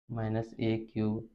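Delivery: ordinary talk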